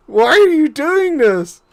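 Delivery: exasperated plea. Real, not acted.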